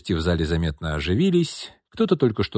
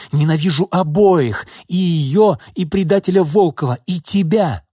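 higher, louder furious scream; 6 semitones higher, 3 dB louder than usual. Acted. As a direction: hurl every word at her. Jilted sister screams accusations.

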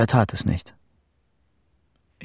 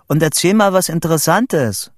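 high, raised urging; calling out; 7 semitones higher, 4 dB louder than usual. A narrator's reading. Vito wanting news from his friend, calling out. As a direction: call it eagerly down the line. Urges friend on over tin phone.

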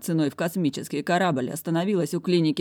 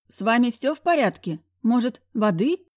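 ingratiating secretary; 7 semitones higher, 4 dB louder than usual. A narrator's reading. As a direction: coax him like a fussing secretary. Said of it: "вкратчиво поинтересовалась".

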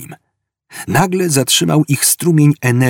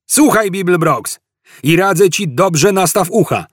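raised-pitch insistent police warning; calling out; 8 semitones higher than usual. A narrator's reading. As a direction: call a warning to the fugitive. Cop shouting advice to fugitive. (wołanie ostrzegawcze, podniesionym głosem)

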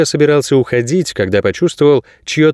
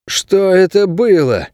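higher, slow, taken aback; stunned question; 9 semitones higher, slower than usual. A narrator's reading. Ingu asks the guard after the shared memories, stunned. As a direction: draw it out slowly in raised, stunned disbelief. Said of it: "ошарашенно спросил Ингу".

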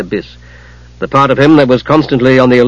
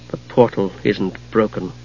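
much softer, murmured; soft, to himself; 4 semitones lower, 9 dB quieter than usual. Acted. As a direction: murmur it to yourself. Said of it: "Palin said to himself softly".

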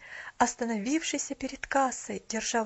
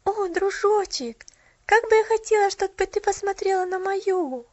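wistful sigh; 9 semitones higher, 7 dB louder than usual. A narrator's reading. Girl wishing the shopgirl could see her tree. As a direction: wish it aloud, sighing. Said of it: "вздохнула девочка".

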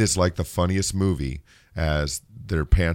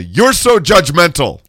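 slow, higher, louder whiny accusation; mock accuser; 8 semitones higher, 9 dB louder, slower than usual. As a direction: drag out the accusation mockingly. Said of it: "I love the".

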